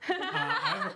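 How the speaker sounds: laughing. Real, not acted. Laughing, mock formal question.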